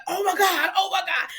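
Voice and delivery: high-pitched mock alarm; retold panic. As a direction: act out panicking, repeating it high. Retold panic after hose slips.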